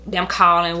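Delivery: usual speaking voice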